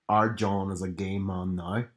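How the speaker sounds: put-on low, drawn-out Northern Irish accent; Northern Irish mum. Quoting a favourite sketch, Northern Irish mum. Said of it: "plays the really Irish, Northern Irish mum".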